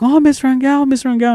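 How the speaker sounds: high-pitched imitation of children calling; excited kids. Kids calling Miss Rangel, high and excited. Kids clamoring for their teacher.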